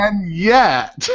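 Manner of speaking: ordinary talk, nothing marked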